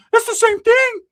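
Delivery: high, loud know-it-all voice; bossy Ricky. Retold or imitated. Ricky insisting.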